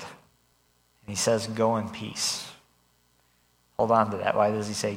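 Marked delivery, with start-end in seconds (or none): 1.5-2.5 slow, tender, softly spoken send-off; gentle blessing. Retold or imitated. Jesus gently blessing the healed woman, slow and tender. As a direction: let the words rest on her kindly.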